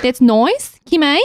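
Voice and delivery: hammed-up suburban Australian vowels; Kath and Kim accent. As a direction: imitate a broad Aussie sitcom accent. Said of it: "watched too much Kath and Kim".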